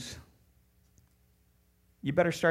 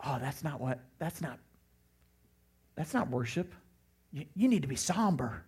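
hushed, halting mock disapproval; disapproving churchgoer; 5 dB quieter than usual. Man behind scolding the dancing youth sponsor, lightly mocked disapproval. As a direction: lightly imitate a soft, hesitant reproof. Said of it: "the guy behind me goes".

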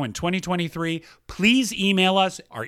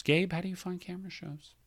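much softer, like a sigh; soft mournful repeat; 2 semitones higher, 8 dB quieter than usual. Shown as a demonstration. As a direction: let it drop to a sad hush. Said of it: "I am going to read one sentence".

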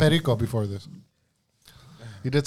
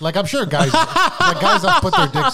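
laughing high through the words; cracking up mid-sentence; 8 semitones higher, 5 dB louder, much slower than usual. Real, not acted. Laughing at guys joking.

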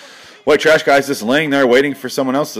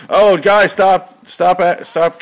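mock yell; 5 semitones higher, 4 dB louder, much slower than usual. Voicing a referee breaking up wrestlers.

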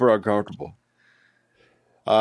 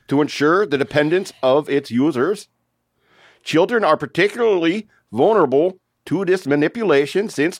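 slow, higher mock Québécois reading; French Canadian accent; 5 semitones higher than usual. Shown as a demonstration. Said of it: "that's me trying to do a French Canadian accent".

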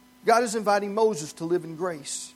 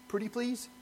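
much softer, higher little plea; meek plea; 4 semitones higher, 9 dB quieter than usual. Shown as a demonstration. Preacher turns the proclamation into a meek request. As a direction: shrink into a timid pretty please. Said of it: "it can be translated more like this".

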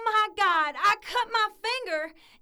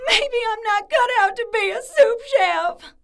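louder tearful wail; crying; 4 semitones higher, 8 dB louder than usual. Acted. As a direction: break down crying over the pressure. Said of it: "are those tears".

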